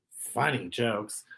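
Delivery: usual speaking voice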